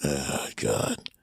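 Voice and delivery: low, soft mock groan of dread; deep, hushed exasperation; exasperated aside. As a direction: mutter it very low, fed up. Retold exasperation at slow customer.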